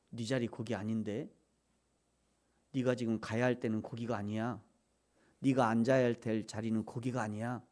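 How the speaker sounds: low, soft, even correction; hushed divine reproof, evenly measured; quiet still voice. Acted. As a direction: tell it gently and very low. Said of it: "조용히 말이죠".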